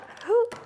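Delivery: sharp high gasp of surprise; a quick delighted intake of breath; surprised gasp. Real, not acted. Chocolates pop out of mold.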